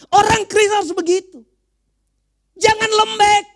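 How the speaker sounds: high, loud, shouted exhortation; full-force preaching. Real, not acted. Preacher urging Christians not to be weak, full-force preaching. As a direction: shout it at the congregation to rouse them.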